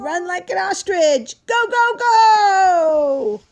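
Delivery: high, loud go go go; rally the child to run; excited cheering. Acted. Parent cheering the child on as she runs, excited.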